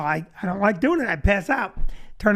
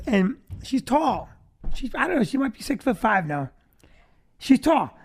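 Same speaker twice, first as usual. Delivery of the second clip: ordinary talk, nothing marked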